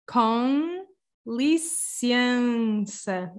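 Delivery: "con licença" with the final m of com turned into a nasal con. Shown as a demonstration. Teacher sounding it out slowly for a learner, nasal con. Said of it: "the weird M at the end that becomes a con".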